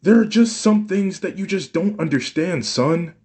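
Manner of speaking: mother shouting back at her son, frustrated. A narrator's reading. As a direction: snap back, hurt and defensive.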